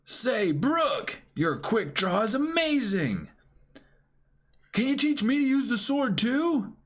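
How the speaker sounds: put-on high-pitched eager boy voice; high eager kid. Reading to co-host, high eager boyish voice. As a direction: ask like an excited young pirate. Voicing Luffy.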